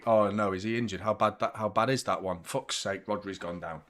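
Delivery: low, sinking dismay. Real, not acted. Fan seeing Rodri go down injured, dismayed. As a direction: let the voice drop and soften in worry.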